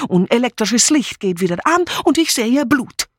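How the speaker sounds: breathless-sounding melodramatic testimony; excitable Mitzi. Acted. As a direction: relive the terror, building in agitation.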